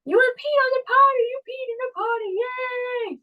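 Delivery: put-on high, excited praise for a toddler. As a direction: gush over a child's success.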